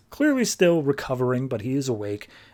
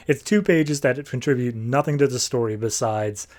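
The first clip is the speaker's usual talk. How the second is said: ordinary talk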